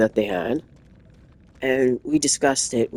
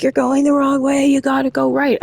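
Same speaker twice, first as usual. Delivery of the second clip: higher and louder, insisting; insistent passenger; 11 semitones higher, 5 dB louder than usual. Retold or imitated. Drunk passenger insisting the driver turn right, louder. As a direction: push the wrong directions as a sure order.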